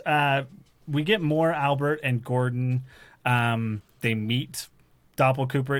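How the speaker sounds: usual speaking voice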